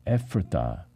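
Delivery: usual speaking voice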